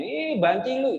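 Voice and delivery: high-pitched jeer imitating peers; mock taunting. Friends taunt a boy who will not smoke, mock jeering. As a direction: tease like a mocking schoolmate.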